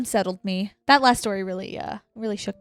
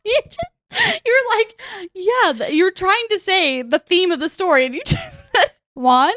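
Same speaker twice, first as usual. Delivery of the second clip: laughing; 8 semitones higher, 7 dB louder than usual. Real, not acted. Retelling blooper while laughing.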